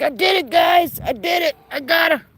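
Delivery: high, excited mock-proud gander; proud goose voice. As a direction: play the gander bragging, voice up high. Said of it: "They're like".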